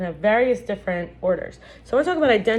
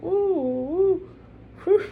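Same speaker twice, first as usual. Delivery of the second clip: slow, much higher dazed ooh ooh and a whew; bewildered ooh; 10 semitones higher, much slower than usual. Shown as a demonstration. Teacher showing students how bewilderment sounds, slow high dazed ooh. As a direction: let the sound drift out slowly, head spinning. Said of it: "When someone feels bewildered, it's like".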